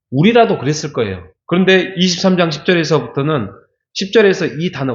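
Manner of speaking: normal talking voice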